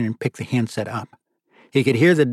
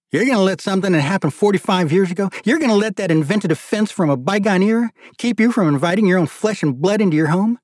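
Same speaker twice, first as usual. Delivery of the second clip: much higher, mounting outrage; growing incredulity; 8 semitones higher, 3 dB louder than usual. Acted. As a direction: build disbelief through the question. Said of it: "his tone becoming more incredulous".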